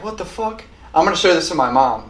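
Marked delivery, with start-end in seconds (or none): 0.0-0.6 high, soft quoted reaction; hushed, high-pitched disbelief; taken aback. Retold or imitated. Reviewer retelling first reaction to the screaming, taken aback. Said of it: "and I'm like".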